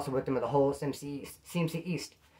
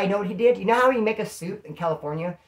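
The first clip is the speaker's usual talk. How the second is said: ordinary talk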